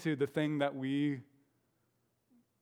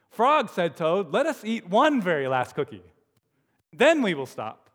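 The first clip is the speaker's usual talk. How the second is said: high, coaxing Toad; Toad's voice; 3 semitones higher, 6 dB louder than usual. Acted. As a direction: Toad pleading for one more cookie.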